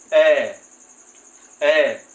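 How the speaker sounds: loud, clear short ए said twice; demo vowel ए. Shown as a demonstration. Teacher sounding out ए for students, loud and clear. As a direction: sound out ए for the class. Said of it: "इसको बोलेंगे".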